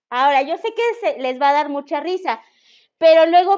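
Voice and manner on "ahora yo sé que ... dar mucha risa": ordinary delivery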